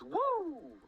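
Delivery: sudden high whoop; excited woo. Retold or imitated. Describing a cartoon plot, an excited whoop. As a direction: whoop as the plot goes wild. Sound of episode going wild.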